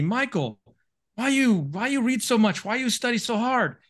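high-pitched imitation of a Chinese tiger mom's accent; Chinese mother's accent. Girlfriend's Chinese mom questioning him as he studies, imitated accent. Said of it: "that was literally her accent".

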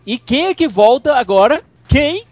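rising, teasing drumroll of questions; playful build-up. Pretend. Host hyping a returning character, excited build-up.

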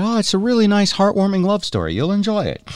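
high, falsely sweet recommendation; mock sweet. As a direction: sell it with fake innocence. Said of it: "we said".

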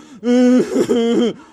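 bright burst of laughter; laughing at himself mid-story. Real, not acted. Pastor laughing at his own gloomy day, loud laugh.

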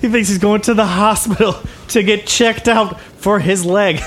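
high, sneering put-on voice; mocking gang leader. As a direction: jeer to your gang, laughing. Game master plays mocking gang leader.

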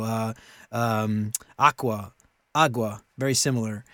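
normal talking voice

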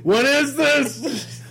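high, slow, baffled whine; bewildered high voice. Retold or imitated. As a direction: act totally lost with technology. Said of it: "I was like".

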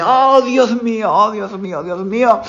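high-pitched horrified gasp of realisation; panicked dismay. Retold or imitated. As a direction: relive the shock of waking to the broken bed.

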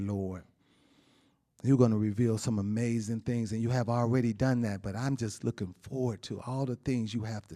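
low, soft, prayerful voice; closing prayer. Acted. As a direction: pray it quietly to the Lord. Closing prayer after the sermon.

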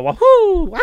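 Mario-style whoop; a light imitation of a game hero's cheer; high-pitched game voice. Recalling the plumber's catchphrase, imitated high whoop. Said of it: "the high pitchedness of it".

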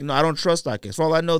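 ordinary talk

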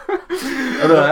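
squeaky laugh through the words. Real, not acted. Cracking up while telling the story, laughing. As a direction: laugh it out high.

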